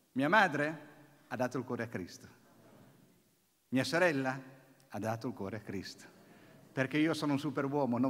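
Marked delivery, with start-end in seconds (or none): none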